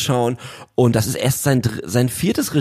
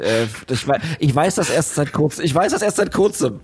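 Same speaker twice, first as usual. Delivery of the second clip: ordinary talk, nothing marked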